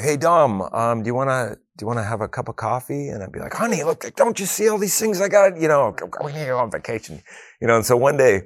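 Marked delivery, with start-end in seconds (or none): 0.0-3.1 higher, gently coaxing wife's voice; a lightly voiced wife offering coffee to tempt him; imitating wife. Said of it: "my wife would be like". 3.5-7.2 high, strained, fed-up pleading; a swamped husband pushing his wife away; exasperated brush-off. Retold or imitated.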